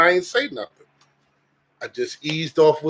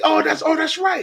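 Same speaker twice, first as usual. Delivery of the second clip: high yelling street voice; shouting stranger; 11 semitones higher than usual. Retold or imitated. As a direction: holler it like the man outside. Voicing the beggar.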